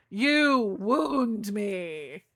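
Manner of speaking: very slow, stretched-out melodrama; hammed-up hurt, lingering on "wound"; mock wounded. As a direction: drag out every word as if fading from hurt. Repeats the mock hurt, more drawn out.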